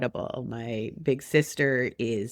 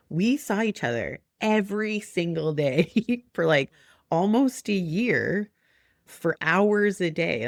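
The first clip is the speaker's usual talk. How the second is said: emphatic retort; 4 semitones higher than usual. Retold or imitated. Mother answering a friend's husband, emphatic retort with a laugh. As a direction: stretch out the key words to prove the point.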